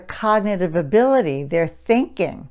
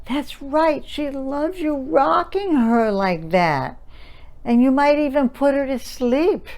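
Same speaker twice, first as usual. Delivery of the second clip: mommy voice; 7 semitones higher than usual. Shown as a demonstration. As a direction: praise the toddler in a lilting voice. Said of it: "And Mommy says".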